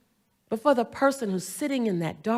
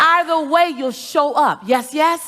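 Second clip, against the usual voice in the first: higher, slow, emphatic rally; motivational preaching; 5 semitones higher, 8 dB louder, slower than usual. Acted. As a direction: preach it to the crowd. Motivational call and response.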